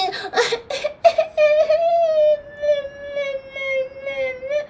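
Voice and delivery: very high, long drawn-out whimper; sad wailing sob. Acted. Grandbaby denied the last box, long high sobbing wail. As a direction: break into a child's held crying whine. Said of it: "moved by all this sadness".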